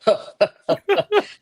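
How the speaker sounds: high odd mock laugh; imitating Arnold's strange laugh; imitated Arnold laugh. Telling the host how Arnold laughs, a mock laugh. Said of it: "in the way how he laughs".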